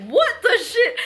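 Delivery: high-pitched retold outburst; shocked disbelief. Retelling being chased by supposed officers, shocked high-pitched disbelief. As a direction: squeal it in mock disbelief.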